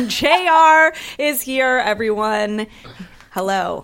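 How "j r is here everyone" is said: loud, slow, laughing welcome; excited announcement. Real, not acted. Announcing the guest.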